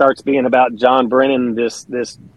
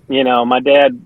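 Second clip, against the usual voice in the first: ordinary talk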